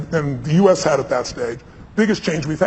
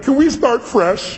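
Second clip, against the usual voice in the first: a higher, slow, friendly reset; fresh start; 7 semitones higher, much slower than usual. Retold or imitated. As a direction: play Reagan offering a clean slate, slowly. Reagan turns back, resets.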